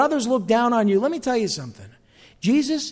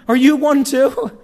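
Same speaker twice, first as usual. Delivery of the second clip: high, loud, drawn-out incredulous question; mock disbelief; 6 semitones higher, 7 dB louder, slower than usual. Pharisees questioning Nicodemus as a supposed Galilean, mock disbelief. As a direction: stretch it out in mocking disbelief.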